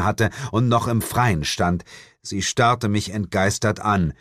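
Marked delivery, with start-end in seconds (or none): none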